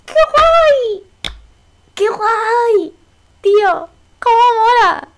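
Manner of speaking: high, loud delighted squeals; thrilled squeal. Real, not acted. Gamer squealing at the fusion, high and loud.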